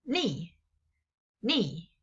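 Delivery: ni dropping sharply; teacher showing the falling fourth tone ni. Shown as a demonstration. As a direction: say ni falling hard. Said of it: "the last one is".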